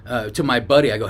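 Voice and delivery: ordinary talk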